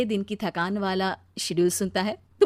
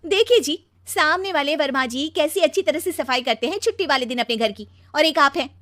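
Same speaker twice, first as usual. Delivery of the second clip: higher-pitched, pointed complaint; nagging wife; 6 semitones higher, 5 dB louder than usual. Acted. Wife comparing husband with the neighbour, nagging. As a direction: nag him with a pointed comparison.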